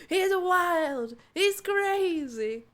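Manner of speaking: high, loud, stretched-out playful holler; hammed-up wild cry. Streamer joking about what the word means, hammed-up holler. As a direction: holler it with mock craziness. Hamming up the word's meaning.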